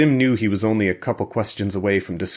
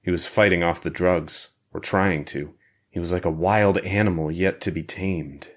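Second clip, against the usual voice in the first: ordinary delivery, nothing marked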